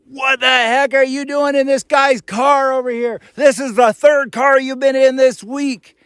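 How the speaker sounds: high mock yell of an angry woman. Woman in pickup scolding the vlogger, high mock yell.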